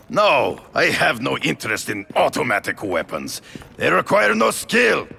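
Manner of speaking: rising to a high, ringing shout; snapping, then proclaiming I am an artist!; furious outburst. Acted. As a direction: lose his temper on the phone. Phone call, furious at weapons.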